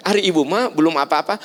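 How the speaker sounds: ordinary talk, nothing marked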